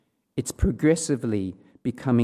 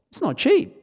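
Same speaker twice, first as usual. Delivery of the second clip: squeaky aside; 13 semitones higher than usual. Real, not acted. Aside on shoe prices.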